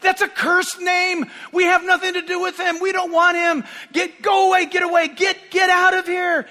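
high furious shouting, retold; angry shouting. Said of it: "came to me and said".

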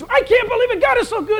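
a retold, bubbling rush of wonder; amazed joy. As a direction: burst with disbelief at the good news.

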